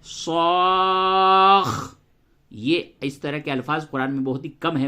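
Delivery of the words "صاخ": صاخ very slow and louder, final kha held; doubled kha. Shown as a demonstration. Teacher repeats the doubled kha louder. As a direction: land firmly on the kha of صاخ. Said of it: "کیسے بولا؟".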